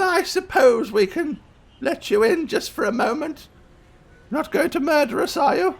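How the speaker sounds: put-on high-pitched, exasperated old lady; worn-down grandmother. Grandma relenting and questioning the visitor, worn down. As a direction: give in reluctantly to a pestering child.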